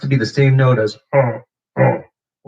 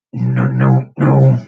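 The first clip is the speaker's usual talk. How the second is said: low buzzing bass growl; vibration bass; 10 semitones lower, 5 dB louder, much slower than usual. Shown as a demonstration. Teaching a beatbox bass, low rumbling demo. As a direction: rumble it deep in the throat. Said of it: "vibration bass".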